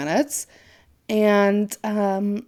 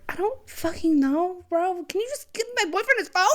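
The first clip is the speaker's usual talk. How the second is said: high, exasperated mock snap at the guy; fed up, pitched way up in disbelief; exasperated retort; 9 semitones higher than usual. Retelling telling the office guy off, exasperated high voice.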